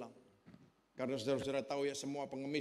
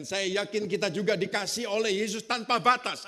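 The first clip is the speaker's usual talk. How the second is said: full-force preaching; 6 semitones higher, 8 dB louder than usual. Acted. Pastor to his congregation about being planted, preaching at full force. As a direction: pound out each phrase to the congregation.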